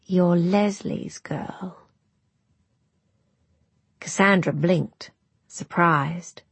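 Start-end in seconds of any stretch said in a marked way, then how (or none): 0.0-1.8 put-on very slow, frail old voice; drawn-out recognition from a dying woman. Frail grandmother recognizes her.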